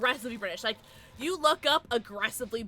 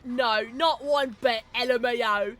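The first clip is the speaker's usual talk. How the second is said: put-on British accent; 7 dB louder than usual. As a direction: read it out as an exaggerated Brit. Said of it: "that's how I'm reading your message".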